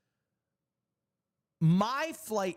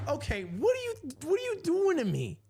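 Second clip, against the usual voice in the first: squeaky, pleading disbelief, much higher; high exasperated whine; 13 semitones higher than usual. Retold or imitated. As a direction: whine it out like you can't believe this. Exasperated at airport agent.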